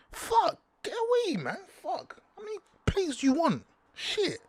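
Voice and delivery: very high, whining mock voice; squeaky high jealous moaning; jealous whiny voice. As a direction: whine it up high like a jealous fan. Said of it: "I'm speaking like this 'cause I'm jealous".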